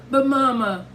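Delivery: put-on high, pleading little-girl voice; starting to sob. A narrator's reading. Daughter protests to her mother, starting to get sobby. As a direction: protest high, on the edge of tears. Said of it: "starting to get all sobby".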